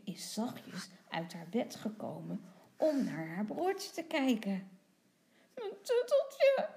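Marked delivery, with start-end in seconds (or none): 5.5-6.7 put-on high-pitched toddler wail, louder; tearful little boy. A narrator's reading. Tommy cries to his sister for his lost comforter, wailing. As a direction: wail it like an upset small child. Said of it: "huilt Tommy".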